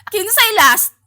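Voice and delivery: high, loud bossy question; loud demanding. Retold or imitated. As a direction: call out to the whole queue. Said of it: "mo-announce dapat kapag abot nimo".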